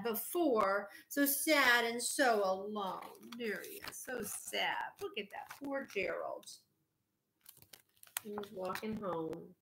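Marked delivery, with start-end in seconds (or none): 3.4-4.0 hushed aside. Real, not acted. Parent pausing the story to show the child a picture, hushed. 4.0-4.9 whisper. Real, not acted. 5.0-9.5 hushed aside. Real, not acted. Parent pausing the story to show the child a picture, hushed.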